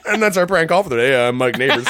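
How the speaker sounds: high, loud mock host outro; radio host sign-off. Mocking a radio host after his failed prank call, loud. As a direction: imitate a host wrapping up a lame bit.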